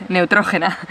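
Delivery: NEUTROGENA as "neh-oo-TRO-heh-na"; Spanish pronunciation. Shown as a demonstration. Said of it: "That's how you say it".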